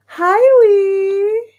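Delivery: high, loud squeal of hello; bright excited hi; excited greeting. Real, not acted. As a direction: greet her with a delighted squeal. Greeting arriving viewer excitedly.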